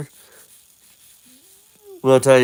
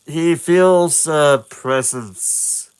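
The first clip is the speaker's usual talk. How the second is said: ordinary delivery, nothing marked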